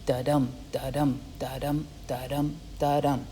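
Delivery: soft da-dum, da-dum like a heartbeat; quiet heartbeat chant; heartbeat rhythm. Shown as a demonstration. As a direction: beat it out gently, stress on dum. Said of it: "sounds a lot like a heartbeat".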